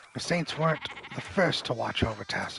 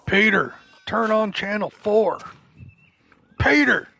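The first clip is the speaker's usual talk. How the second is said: loud, high bellowing of the name; neighbor yelling through walls; 9 semitones higher, 9 dB louder than usual. Retold or imitated. Mullet neighbor hollering at Peter through the wall, loud yell. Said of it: "That's what I think of anytime I see the name Peter".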